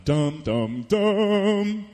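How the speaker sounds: hummed dum dum of stage suspense music, rising on the last; dramatic music hum. Pretend. Preacher to his congregation, humming dramatic suspense music. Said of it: "there would be this kind of music going on".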